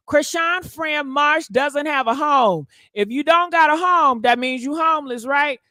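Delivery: ordinary talk, nothing marked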